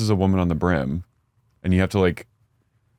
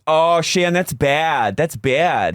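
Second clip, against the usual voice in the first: 1 mock Gina accent; 5 semitones higher, much slower than usual. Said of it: "She goes".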